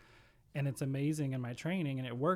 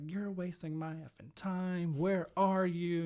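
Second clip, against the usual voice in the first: slow, raised, exasperated telling-off; mock angry rant, higher and slower; imitated cussing out; 5 semitones higher, slower than usual. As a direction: act out a grouch losing patience, dragging each word. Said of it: "He starts cussing me out".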